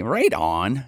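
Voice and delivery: a light Ontario "Right on"; Ontario accent. Retold or imitated. Mocking how Ontario people talk, lightly Canadian. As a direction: drop into a lazy Ontario accent. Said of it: "Ontario people have this kind of".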